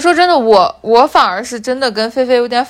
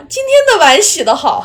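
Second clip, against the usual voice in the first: imitating mom; 8 semitones higher, 4 dB louder than usual. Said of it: "我妈".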